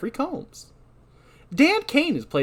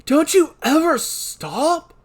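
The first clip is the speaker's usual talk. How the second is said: high, loud and slow; fed up and drawn out; exasperated shout; 6 dB louder, slower than usual. Acted. Dan voiced, exasperated.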